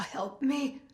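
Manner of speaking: soft, airless plea; faint strangled plea; breathless plea. A narrator's reading. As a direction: squeeze it out with no air. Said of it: "Sucking air through a straw".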